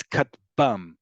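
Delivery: katbam stressed on the last syllable, kat-BAM. Shown as a demonstration. Teacher showing the default emphasis, "katbam" stressed on its last syllable. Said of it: "the last syllable is the one that's emphasized".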